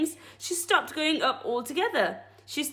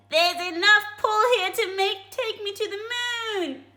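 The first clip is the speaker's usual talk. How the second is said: higher, excited wife's voice; Mrs Twit crying out; 9 semitones higher, 3 dB louder than usual. Acted. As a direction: cry out as the nasty Mrs Twit. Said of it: "Missus Twit cried out".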